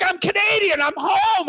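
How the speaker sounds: mock-outraged insisting he belongs; indignant protest. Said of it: "And I'm like".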